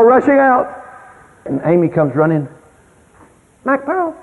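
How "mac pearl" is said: put-on high, soft child's voice; little girl calling. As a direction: call out sweetly like a toddler. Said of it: "That's what she calls me".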